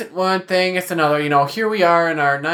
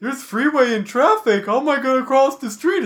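confused deer voice; 10 semitones higher than usual. Retold or imitated. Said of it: "and they go".

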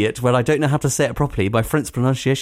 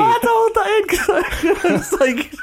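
laughing; slower than usual. Real, not acted.

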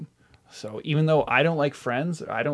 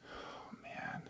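whispered; 23 dB quieter than usual. Real, not acted. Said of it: "overwhelmed by the question".

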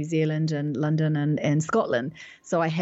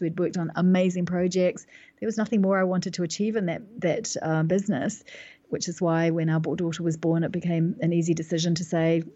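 ordinary talk, nothing marked

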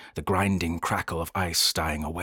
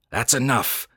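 raised, curt cut-off; snapped; 5 semitones higher, 4 dB louder than usual. A narrator's reading. Travis cutting off Noscat's warning, snapping. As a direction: break in firmly with mild anger. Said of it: "snapped Travis".

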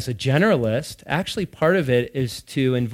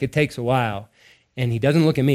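usual speaking voice

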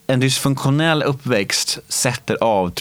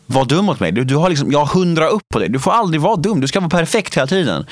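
upset demanding outburst; 6 semitones higher than usual. Retold or imitated. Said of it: "Och jag bara".

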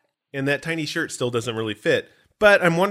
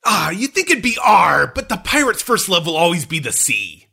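hammy pirate growl, a bit louder; 4 semitones higher, 6 dB louder than usual. Pretend. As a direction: ham it up like an old sea dog. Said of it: "gotta do it in my pirate voice".

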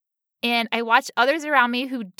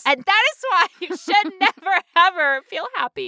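laughing; 6 semitones higher than usual. Real, not acted. Joking that happiness gets punished.

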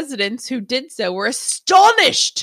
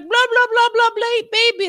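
mocking babble; 11 semitones higher, 4 dB louder than usual. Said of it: "And Jacob's like".

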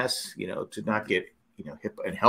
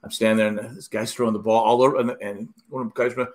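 usual speaking voice

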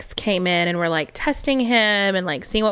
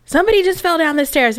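alarmed; 9 semitones higher, 4 dB louder, faster than usual. Retold or imitated. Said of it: "our friend Jordan was like".

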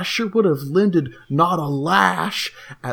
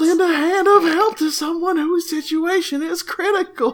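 mock indignant forum rant; 8 semitones higher than usual. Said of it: "reading out forum post mockingly".

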